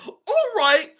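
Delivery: high-pitched mock agreement; mock eager compliance. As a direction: pipe up like an obedient follower. Mocks a blindly obedient follower.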